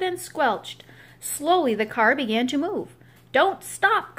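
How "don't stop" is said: higher, louder call of encouragement; Mister Grumpy calling out high to the pushers; urgent cry. Acted. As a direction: cry out to keep them pushing. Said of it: "cried Mister Grumpy".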